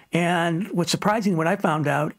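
ordinary talk, nothing marked

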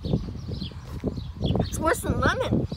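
slow, strained, puckered speech; eating sour candy. Real, not acted. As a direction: squeeze the words out while puckering.

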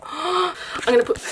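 normal talking voice

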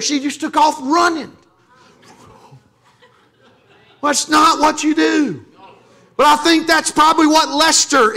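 high-pitched preaching that climbs and swells; fiery preaching. Acted. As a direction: lift the congregation as it builds to a peak. Preaching builds to full force.

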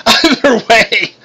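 high, loud words broken by laughter; between guffaws. A narrator's reading. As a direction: laugh through the slow, drawn-out words. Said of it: "Eitri said between guffaws".

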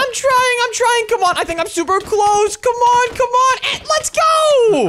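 high-pitched, straining to win; frantic push that ends in a drawn-out whoop; urgent excitement. Acted. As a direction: urge yourself on, building to a cheer.

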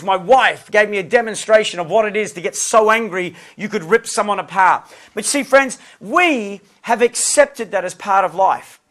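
normal talking voice

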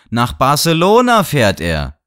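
high, loud, slow stunned shout. A narrator's reading. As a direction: bellow it out as if leaping up. („Nach Barcelona fährt er!“ laut, hoch und langsam geschrien)

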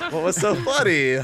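laughing loudly through the words. Real, not acted. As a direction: burst out laughing while asking. Laughing taunt.

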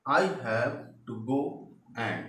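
slow, careful reading of the example; slow dictation. Shown as a demonstration. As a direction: read it slowly for the class to follow. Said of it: "reading example sentence to class". (धीरे-धीरे उदाहरण पढ़ना)

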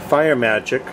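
ordinary talk

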